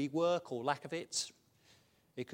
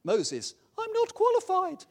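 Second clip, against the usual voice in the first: much higher, louder pleading voice; whiny Moses; 12 dB louder, slower than usual. Acted. Acting Moses protesting to God.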